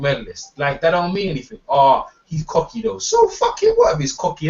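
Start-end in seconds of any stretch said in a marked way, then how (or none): 3.0-4.5 indignant outburst. Real, not acted. Heated rant about Floyd.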